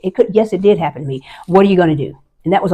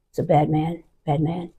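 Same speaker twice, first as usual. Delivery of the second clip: hushed; 9 dB quieter than usual. Retold or imitated. Hiding, speaking hushed to housekeeper.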